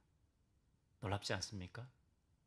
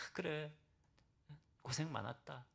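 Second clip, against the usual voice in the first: softer, tender words of comfort; gentle comfort; 7 dB quieter than usual. Pretend. God comforting the exhausted Elijah, soft and tender. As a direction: say it like a hug after a long struggle. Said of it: "허그해 주는 거죠".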